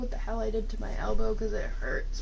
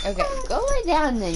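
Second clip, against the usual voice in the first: higher and louder, fed up; exasperated dismissal; 5 dB louder than usual. Real, not acted. Owner sending her pestering dog off to lie down. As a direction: shoo the dog off.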